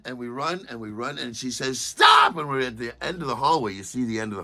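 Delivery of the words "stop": high, loud command; a sharp high shout; shouted stop. Retold or imitated. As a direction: bark the order to halt. Airport woman halting the run.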